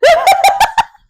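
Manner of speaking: high, loud laugh; real burst of laughter. Real, not acted. Laughs at yawning story.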